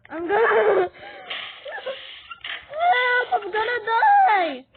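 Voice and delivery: high, loud game panic; frantic shout rising into a scream; panicked scream. Pretend. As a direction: shriek as if about to die. Panics in game, about to die.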